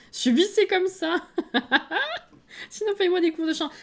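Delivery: high and loud, laughing through it; playful defiant laugh; laughing defiance. Real, not acted. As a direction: tell them to deal with it, cracking up. Defiant joke to audience.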